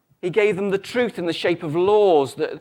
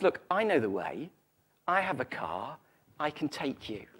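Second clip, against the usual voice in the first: softer, lower, unhurried reassurance; simply stated; 4 semitones lower, 7 dB quieter than usual. Acted. Second Italian offering the couple a lift, simple and calm. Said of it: "he simply says".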